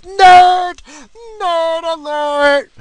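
very high, loud teasing yell; mock nerd shout. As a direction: shriek it like a playground taunt. Mocking a Star Wars fan.

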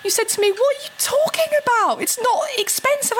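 high, fast mock of a dismissive husband. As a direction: wave her worry away, lightly voiced. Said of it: "And he's like".

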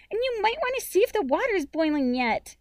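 high, loud little-sister whine; high-pitched, whiny nagging. A narrator's reading. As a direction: nag big brother in a child's whine. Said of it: "in her most whiny voice".

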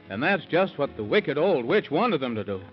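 ordinary delivery

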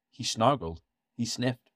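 ordinary delivery

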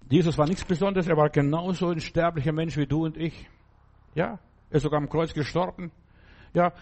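ordinary talk, nothing marked

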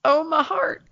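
high-pitched, retold gush of affection; touched gushing. Retelling her reaction to a sweet old man, touched and gushing. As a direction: coo it high, as if the heart just melted.

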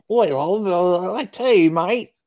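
loud, high cockney British impression; British accent. Co-host showing his British accent to the host, loud cockney British. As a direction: belt it out like a cartoon Brit. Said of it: "that's a British accent?".

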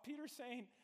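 normal talking voice

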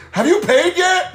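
high, drawn-out bus driver challenge; mock indignant demand. Bus driver cuts off a complaining rider, mock indignant.

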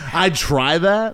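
slow mocking quote; mocking drawl. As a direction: stretch it out mockingly. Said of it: "and you say".

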